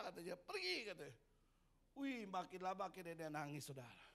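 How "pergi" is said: scornful cat shooing. Acted. Cat chases dog away.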